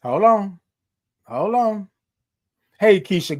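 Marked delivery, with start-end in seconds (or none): none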